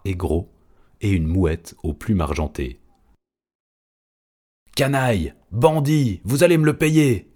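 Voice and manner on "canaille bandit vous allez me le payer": high, loud screeching monkey rage; enraged chimp yelping threats; furious yelp. A narrator's reading. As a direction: shriek the insults like an outraged ape. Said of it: "glapit le chimpanzé".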